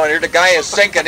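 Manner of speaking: ordinary talk, nothing marked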